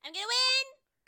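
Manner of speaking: excited battle cry; shouting. Acted. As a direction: yell it out eager to win. Eating contest starts, character shouts.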